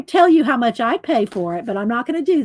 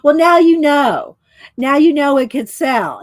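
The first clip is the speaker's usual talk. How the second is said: laughing; 2 semitones higher, 7 dB louder than usual. Real, not acted. Laughing retort.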